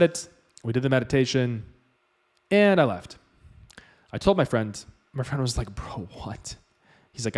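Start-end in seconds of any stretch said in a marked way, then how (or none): 5.7-6.6 hushed, breathy bro what; friend's disbelief. Retold or imitated. Friend hearing about Titus, stunned whisper. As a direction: whisper it, floored.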